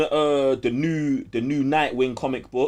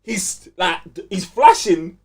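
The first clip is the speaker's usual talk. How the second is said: higher, louder, breathless retelling; excited storytelling; 6 semitones higher, 5 dB louder than usual. Real, not acted. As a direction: tell it like the best bit. Telling the show's climax excitedly.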